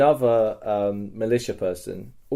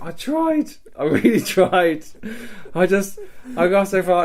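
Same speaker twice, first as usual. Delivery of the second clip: high, loud, plaintive imitation; mock plaintive; 9 semitones higher, 6 dB louder than usual. Recaptured runaway explaining himself to his rescuer, mock plaintive. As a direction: protest helplessly in a raised, pitiful voice.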